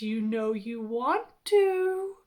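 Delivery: slow, teasing husky temptation; husky hot dog voice. A narrator's reading. Hot dog tempting hungry Reggie, husky and slow. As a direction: draw it out slowly in a husky voice. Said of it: "in its husky, hot doggy voice".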